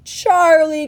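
high, loud, fluty exclamation; effusive, ringing greeting; delighted cry. A narrator's reading. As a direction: cry the name out in joyful recognition. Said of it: "cried Mister Wonka".

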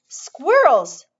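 high, loud spoiled shout. A narrator's reading. Veruca shouting at the squirrel sight, high and loud. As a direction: yell it out greedily. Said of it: "shouted Veruca Salt".

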